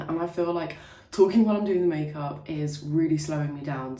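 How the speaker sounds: normal talking voice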